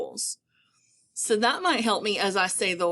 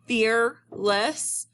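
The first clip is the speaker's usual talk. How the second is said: FEARLESS split slowly into "fear" and "less"; syllable by syllable. Shown as a demonstration. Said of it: "I am hearing two syllables".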